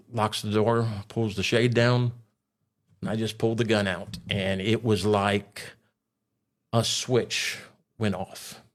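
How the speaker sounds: ordinary delivery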